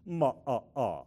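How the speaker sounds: vampire count's chopped laugh. Shown as a demonstration. Showing the congregation the laugh in a count-like voice. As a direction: space out each "ah" like a cartoon count. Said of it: "We're gonna do this".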